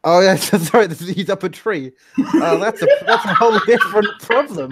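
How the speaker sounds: high, loud laughing apology. Real, not acted. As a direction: laugh through the words. Player laughing at own mistake.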